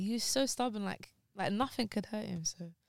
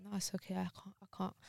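choked up; 9 dB quieter than usual. Real, not acted. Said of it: "I can't do my crying voice on".

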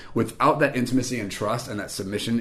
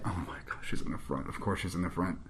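very soft, low, under the breath; muttered dismay; 5 semitones lower, 14 dB quieter than usual. Retold or imitated. Latecomer learns her seat is up front, muttered dismay. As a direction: groan it quietly to yourself.

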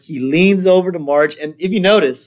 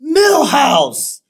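outraged high shout; imitated kid's betrayed holler; Bart; 13 semitones higher, 4 dB louder than usual. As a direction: yell the name in disbelief. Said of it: "Bart just can't believe it".

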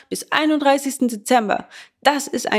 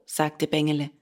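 ordinary delivery

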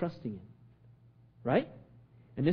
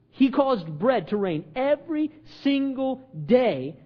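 emphatic sermon, key words stretched out; impassioned preaching; 5 semitones higher, 4 dB louder than usual. Acted. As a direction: preach it with swelling conviction. Preaches on God providing.

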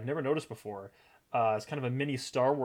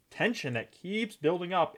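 normal talking voice

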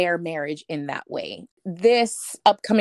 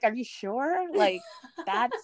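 half-laughing, higher unsure question; laughing doubt; 5 semitones higher than usual. Retold or imitated. Asking Josh if she should hyphenate, laughing. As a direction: giggle through the doubt.

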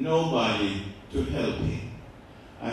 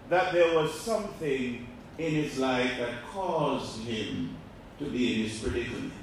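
normal talking voice

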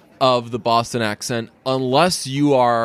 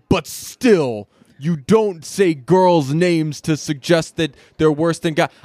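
higher-pitched imitation of scolding commenters; mock indignation; 4 semitones higher, slower than usual. Imitating people replying to a tweet, mock indignant. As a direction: put on an indignant objector, pitched up and drawn out. Mimicking indignant online replies.

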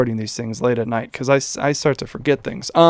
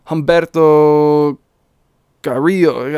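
half-hearted Spanish pronunciation of the name; rolled Spanish r in Carrillo, Spanish accent; Spanish accent attempt; 4 dB louder, slower than usual. Shown as a demonstration. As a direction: try the name the Spanish way, a bit louder. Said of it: "not great at accents".

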